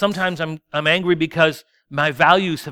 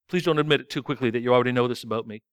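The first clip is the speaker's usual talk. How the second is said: ordinary talk, nothing marked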